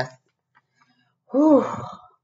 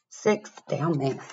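plain reading voice

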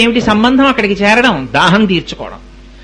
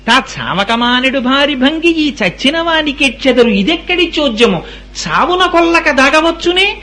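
high, slow poetic chant; verse recitation; 5 semitones higher, slower than usual. Acted. Preacher quotes verse on weeping for the dead, high chant. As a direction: intone the verse with drawn-out vowels.

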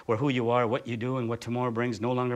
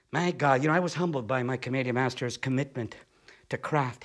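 ordinary delivery

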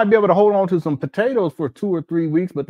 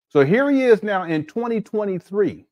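ordinary talk, nothing marked

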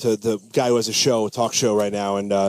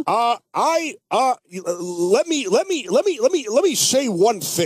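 Rush Limbaugh impression; 8 semitones higher than usual. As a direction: stammer and bluster like a pompous radio pundit. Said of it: "What would Rush Limbaugh say about that?".

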